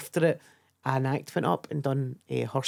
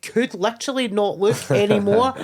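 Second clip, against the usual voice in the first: higher and louder incredulous rant; exasperated retort; 5 semitones higher, 4 dB louder than usual. Retold or imitated. Snapping back at the posh comic about Europe, exasperated. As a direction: let the disbelief spill over.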